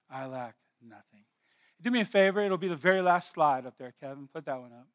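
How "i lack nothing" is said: hushed repeat. Acted. Repeats verse very softly.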